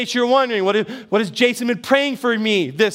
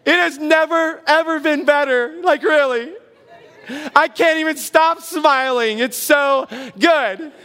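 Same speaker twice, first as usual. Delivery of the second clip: fake happy; 5 semitones higher than usual. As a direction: gush through a pasted-on smile, overdoing it. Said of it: "But you smile and you shake their hand and you say".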